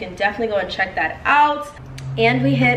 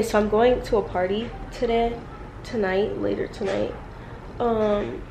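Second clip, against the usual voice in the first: normal talking voice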